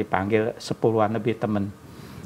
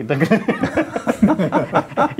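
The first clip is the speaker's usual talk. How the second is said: a long rising laugh; laughing hard at his own joke; 9 semitones higher, 4 dB louder than usual. Real, not acted. (tertawa panjang dan keras)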